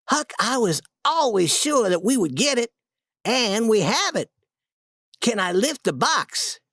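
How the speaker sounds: a hint of a boy gloating over treasure; gently triumphant young Tom; lightly voiced proud Tom.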